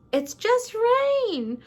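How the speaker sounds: high, drawn-out Southern lament; Southern accent. Acted. Narrator voices Blanche dismissing the storm, hammed-up Southern accent. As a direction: wail it slowly in a put-on Southern accent. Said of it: "That was my best Southern accent".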